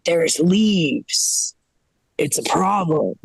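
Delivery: low, mock-serious caller; grown man caller. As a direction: lightly act a grown man stating a grave complaint. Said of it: "phone call from this grown man".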